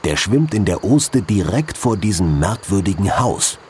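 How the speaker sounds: ordinary delivery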